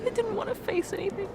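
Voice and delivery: high, broken, tearful voice; crying. Acted. Admitting the truth to her partner, crying. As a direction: fight through the tears to say it.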